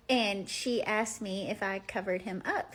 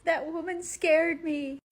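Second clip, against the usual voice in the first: high, loud little-boy voice, retold; acted frightened toddler, raised pitch; imitating scared toddler; 8 semitones higher, 5 dB louder than usual. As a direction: point the blame like a frightened small child. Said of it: "And he was like".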